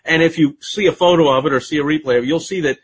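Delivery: ordinary talk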